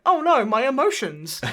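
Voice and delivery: playfully overwhelmed by your own feelings; mock emotional. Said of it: "I got emotional. I was like".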